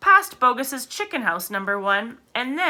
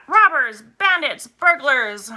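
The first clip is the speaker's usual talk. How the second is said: higher, louder shrieks; shrieking Rat; 5 semitones higher, 4 dB louder, slower than usual. A narrator's reading. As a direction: yell each word after the thieves. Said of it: "shrieked Rat".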